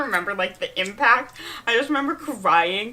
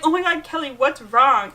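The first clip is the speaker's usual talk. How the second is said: worried sister; 5 semitones higher, 5 dB louder than usual. Retold or imitated. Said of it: "she's like".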